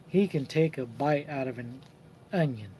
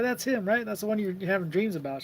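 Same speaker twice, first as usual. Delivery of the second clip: teasing; 6 semitones higher than usual. Retold or imitated. Teasing in front of the boy.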